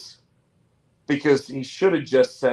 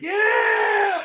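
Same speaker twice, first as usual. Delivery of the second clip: high mock crowd shout; crowd cheer; 3 dB louder than usual. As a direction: imitate a whole arena yelling back. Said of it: "all of a sudden everybody's like".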